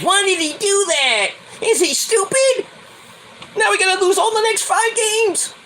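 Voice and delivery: high, mocking whine of a fake fan; mock the panicky fans; whiny fake fan. Vlogger mocking fake Met fans, high whine. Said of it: "All these fake Met fans".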